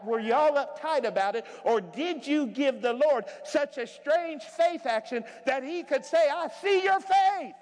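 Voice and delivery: loud, high preaching that keeps climbing; full-force preaching. Acted. Preacher to his congregation, loud and rising. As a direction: preach it out to the whole church.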